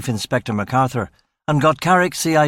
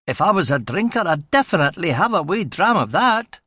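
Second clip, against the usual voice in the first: higher, hammed-up bad Scottish accent; a deliberately terrible Scottish accent; awful Scottish accent; 6 semitones higher than usual. Young English constable showing off to the RUC men, awful Scottish accent. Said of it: "doing an awful Scottish accent".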